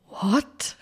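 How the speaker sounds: a light retold shock, not real yelling; half-laughing disbelief at the coincidence; stunned what. Retold shock at Matt Colby link.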